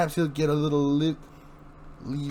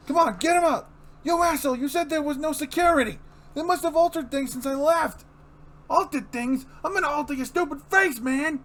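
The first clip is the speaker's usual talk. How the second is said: high-pitched furious crook yelling at his partner; angry thug; 14 semitones higher, 4 dB louder than usual. Acted. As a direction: blame him, full panic and rage.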